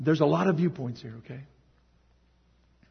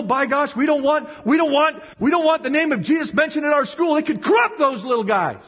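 raised, louder indignant protest; mock indignation; 8 semitones higher, 4 dB louder than usual. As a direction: sputter in mock outrage at a scandal. Voices society's indignant objection.

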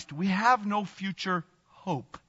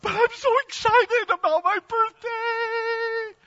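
put-on very high, slow, gushing child voice; excited little girl; 3 dB louder, slower than usual. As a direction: squeal it like a delighted child.

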